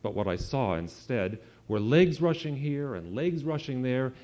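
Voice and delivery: usual speaking voice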